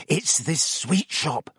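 slow, dreamy longing. A narrator's reading. Ron to Hermione, dreamily picturing the sweet shop. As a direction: drift off thinking of sweets. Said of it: "a dreamy look coming over his face".

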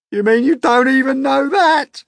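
high, loud mocking centipede; mocking cry. A narrator's reading. Centipede mocking James from the crowd, high and loud. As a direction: jeer at the boy's ignorance. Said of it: "cried the centipede".

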